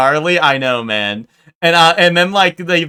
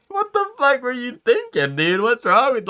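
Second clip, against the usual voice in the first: much higher, mock disbelief; retelling the shock of a prank at the shop counter, pitched way up; incredulous outburst; 10 semitones higher than usual. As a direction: squeak out total disbelief. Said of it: "And I'm like".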